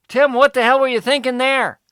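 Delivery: loud, high, exasperated outburst; shouting at the guest for his foolish choice; exasperated yell. Acted. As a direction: blow up at Tim in disbelief. Interviewer scolds Tim.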